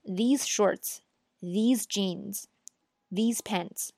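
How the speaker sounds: these lifted at the start of each phrase; stress on these. Shown as a demonstration. Teacher stressing these before each plural item. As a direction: hit every these. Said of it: "永远要是these".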